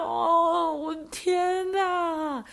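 高声拉长的"我的天哪"; 慢慢惊叹，不敢相信. Retold or imitated. 得知他一直偷看. (高而慢的惊叹)

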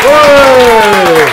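loud excited hey; loud shout. Real, not acted. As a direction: call out a big hey. Host greets guests on show.

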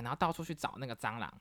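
ordinary talk, nothing marked

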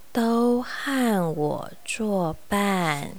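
very slow, soft, whispery sloth; super slow sleepy sloth. Acted. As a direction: drawl it out like a drowsy sloth. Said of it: "他说话很慢，很慢，也很，嗯，很安静".

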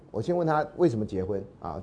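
normal talking voice

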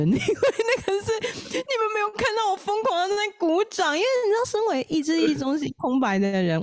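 high voice breaking into laughter; laughing. Real, not acted. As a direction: talk through real helpless laughter. Host laughing hard about clapping.

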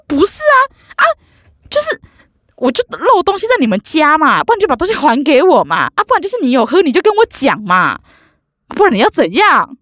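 high-pitched, pleading exasperation; arguing with the neighbour over her missing drink, high and exasperated; exasperated protest. Retold or imitated.

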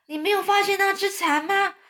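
high-pitched excited exclaiming; calling out excitedly. A narrator's reading. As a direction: cry out the discovery in a high voice. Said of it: "绿色老蚱蜢就大叫".

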